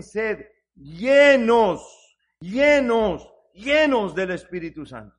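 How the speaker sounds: loud, drawn-out "llenos", repeated; emphatic proclamation. Acted. Preacher urging congregation to be Spirit-filled, emphatic proclamation. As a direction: hold and punch each "llenos", then ease off. Climax of quoted verse, repeated word.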